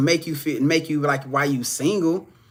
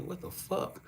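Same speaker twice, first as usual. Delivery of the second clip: unvoiced whispered aside; hushed disbelieving whisper; 19 dB quieter, slower than usual. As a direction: breathe it out in a shocked whisper. Acted quote of inner reaction.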